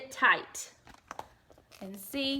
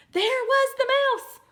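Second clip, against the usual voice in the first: loud high reread shout; high loud surprised shout; excited shout; 8 dB louder than usual. Acted. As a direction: belt it out like a shock. Rereads climax line.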